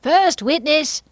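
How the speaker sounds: high, loud, drawn-out summons; calling out. A narrator's reading. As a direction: call the court's witness like a herald. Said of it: "and called out".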